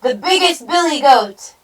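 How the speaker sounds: loud, high proud announcement; storybook hero stepping up; grunted. A narrator's reading. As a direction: declare it boldly as the big goat. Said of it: "he grunted".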